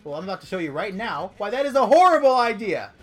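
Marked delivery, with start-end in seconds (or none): 1.4-2.9 sudden loud alarmed cry; panicked yell. Real, not acted. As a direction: yell in a panic as the enemy charges. Fleeing Lu Bu in panic.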